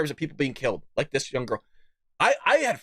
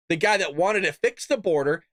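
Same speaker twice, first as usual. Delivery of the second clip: usual speaking voice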